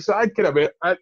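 normal talking voice

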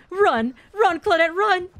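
high, loud urging; urgent shout. Real, not acted. Player calls to fleeing Claudette, urgent shout. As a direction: yell for a teammate to flee.

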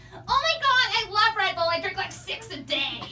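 lightly voiced over-eager fan; gushing girl. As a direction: gush like an excited college girl. Red Bull girl gushes.

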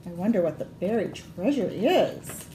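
ordinary delivery, nothing marked

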